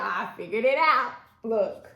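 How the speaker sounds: loud, proud announcement; triumphant. Real, not acted. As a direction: crow about the win. Triumphant after fixing tripod.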